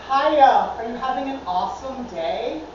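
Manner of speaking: loud, chirpy mock cashier greeting; perky cashier. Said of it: "The girl behind the counter said".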